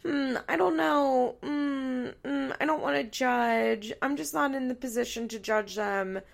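loud, exaggerated hemming and hawing; non-judgmental host. Podcaster imitating a host who won't judge, mock-hesitant. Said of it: "It's not entertaining to listen to someone".

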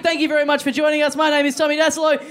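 high, put-on Aussie bloke voice; character host voice. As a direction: play the over-the-top host. Comic character intro voice.

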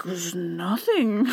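soft, slow, low mock-innocent mumble; sheepish denial. Caught man answers searchers, quiet sheepish denial. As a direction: drag it out quietly, feigning innocence.

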